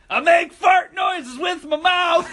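loud, high, slow silly yell; drunken taunting. Retold or imitated. Drunk guy pestering his sleeping brother with a loud, silly yell. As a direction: goof off right in his sleeping face. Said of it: "and I go".

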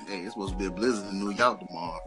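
ordinary talk, nothing marked

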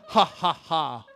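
loud, deliberate ha-ha-ha; Santa-like laughter. Acted. God laughing up there, loud acted laugh. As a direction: laugh out loud in separate beats.

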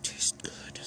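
soft breathy whisper. Acted. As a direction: murmur it under your breath. Intimate scene, whispered reaction.

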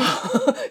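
short rising laugh. Real, not acted. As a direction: laugh brightly. Laughs at predatory journal.

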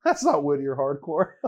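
loud, high disbelief with a laugh; outraged disbelief. Retold or imitated. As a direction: scoff in disbelief.

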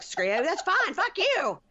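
imitating her swearing in a high squeal; mock furious swearing. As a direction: spit it out like a tantrum. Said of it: "She's like".

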